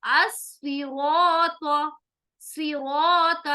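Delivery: अस्सिरात very slow, with a dark heavy r and aa; heavy Arabic as-siraat. Shown as a demonstration. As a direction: stretch अस्सिरात with full tafkheem. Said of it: "सिराता नहीं है".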